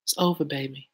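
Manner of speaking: soft, low imitation of an R&B singer. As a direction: drop into a hushed singer's voice. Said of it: "In my [name] voice".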